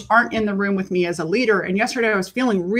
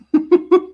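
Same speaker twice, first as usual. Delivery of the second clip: short bright giggle after the story; a quick high laugh, real; 9 semitones higher, 4 dB louder than usual. Real, not acted. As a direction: burst out laughing, pitched up. Laughing about being bitchy.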